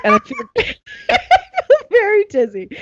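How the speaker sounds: high voice breaking into laughter; laughing through it. Retold or imitated. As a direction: try to say it but crack up. Laughs mid-quote telling doctor.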